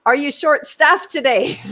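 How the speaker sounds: high, loud call across a room; loud repeated question. Retold or imitated. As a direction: project it at someone avoiding you. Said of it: "said it again".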